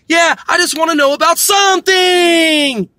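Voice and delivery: put-on high, loud child with a long-drawn final word; mock little cartoon girl. Cartoon girl answers her puzzled teacher, high mock shout. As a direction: answer in a shouted kid imitation. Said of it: "is her talk like this".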